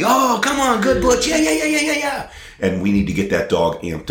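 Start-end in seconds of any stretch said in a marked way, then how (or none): 0.0-2.3 very high, excited squeal of praise for a dog; giddy falsetto cheering; happy. Shown as a demonstration. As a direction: amp the dog up with a squeaky, playful voice. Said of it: "Be happy".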